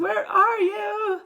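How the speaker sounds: high, drawn-out frantic call; panicked mother calling for her child; screamed. A narrator's reading. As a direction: wail it out slowly and loudly. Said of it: "screamed Missus T V".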